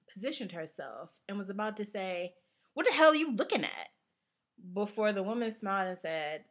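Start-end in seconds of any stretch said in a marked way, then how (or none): 2.7-3.9 high, loud, fast mock challenge; acted confrontation. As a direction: snap it like you're ready to swing. Friend with fists balled, about to confront.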